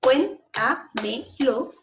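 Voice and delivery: 'Cuéntamelo' very slowly split as cuén-ta-me-lo; syllable by syllable. Shown as a demonstration. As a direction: break 'Cuéntamelo' into four separate syllables. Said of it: "Separamos en sílabas". ('Cuéntamelo' dicho muy lento, en cuatro sílabas)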